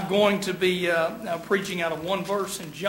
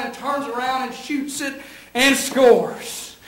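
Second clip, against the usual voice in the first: raised, excited sports commentary; play-by-play; 3 semitones higher than usual. Acted. Preacher relives Kentucky versus Duke for the congregation, as excited play-by-play. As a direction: call the last seconds like a game announcer.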